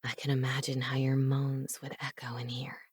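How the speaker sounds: low breathy whisper, voice barely there; seductive whisper. Acted. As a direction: whisper it close and tempting. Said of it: "he continued in that seductive voice".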